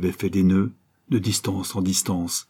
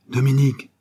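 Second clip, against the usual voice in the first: higher, louder call of the name; 5 semitones higher, 4 dB louder than usual. A narrator's reading. Laurent calling his brother in the dark. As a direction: call out to find him in the dark. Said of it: "appela-t-il".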